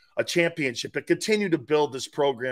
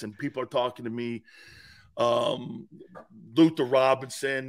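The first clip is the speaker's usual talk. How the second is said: usual speaking voice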